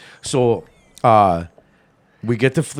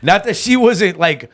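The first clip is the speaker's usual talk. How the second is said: louder, higher, half-laughing protest; 7 semitones higher, 6 dB louder than usual. Real, not acted. Defending his girlfriend to friends, louder and higher. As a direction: push back fast before they judge her.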